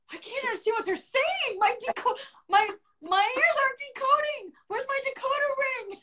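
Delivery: high, thin panicked voice; retold panic. Recalling a screaming boss, high panicked voice. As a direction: squeak it out like you're lost and scared. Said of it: "I was, like, panicking".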